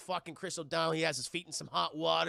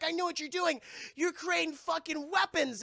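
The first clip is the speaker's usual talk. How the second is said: much higher mock accusation; accusing Ivy; 10 semitones higher than usual. Pamela confronting Woodrue, high mock accusing voice. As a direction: point the finger, hammed. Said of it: "He's like".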